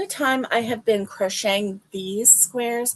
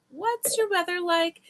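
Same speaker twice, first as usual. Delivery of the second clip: What's your weather like, pitched high and lilting; high sing-song question; 9 semitones higher than usual. Shown as a demonstration.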